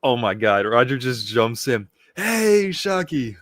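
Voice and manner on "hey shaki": high, drawn-out hail to Shaki; calling out. Acted. Roger jumps in greeting Shaki, calling out. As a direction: call over to her in a raised pitch.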